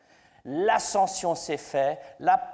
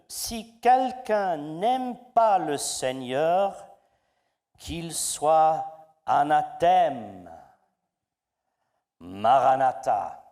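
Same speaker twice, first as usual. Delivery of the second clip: slow, louder, weighty reading of the verse; preacher reading the scripture to his congregation, slow and solemn; 5 dB louder, slower than usual. Acted. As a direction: pronounce each word of the verse slowly, like a proclamation from the pulpit. Reads the Bible verse aloud.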